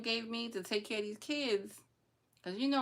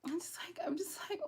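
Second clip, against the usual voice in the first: whisper; 9 semitones higher, 7 dB quieter than usual. Real, not acted. Whispered aside, upset.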